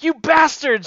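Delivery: loud, high acted outburst; mock outrage. Tricked boy cursing his joking friend, mock outraged.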